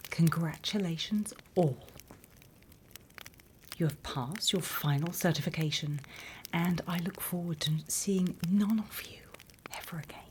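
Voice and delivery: ordinary talk, nothing marked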